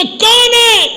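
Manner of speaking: high, loud, arrogant shout; loud angry yell. Acted. Pharaoh shouting at the knocker, arrogant yell. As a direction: bark it out with pride. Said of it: "زور سے چیخا کہ".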